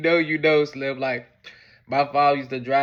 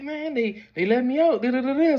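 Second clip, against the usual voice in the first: lightly voiced father, higher; father explaining; 11 semitones higher than usual. Father explaining his release to mother. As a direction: brush it off casually.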